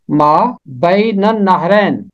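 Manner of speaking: ما بین النہرین slowly, النہرین as annahrain with doubled n; slow pronunciation demo. Shown as a demonstration. Teacher slowly models ما بین النہرین for learners. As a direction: carefully sound out ما بین النہرین. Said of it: "پھر میں پڑھتا ہوں".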